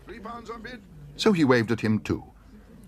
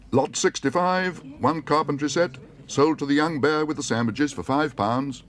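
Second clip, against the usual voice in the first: auctioneer; 7 semitones higher, 3 dB louder than usual. Acted. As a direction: announce the lot to the hall. Auctioneer announcing sale.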